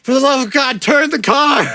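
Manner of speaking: exasperated shout. Retold or imitated. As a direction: yell it in total exasperation. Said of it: "He's like".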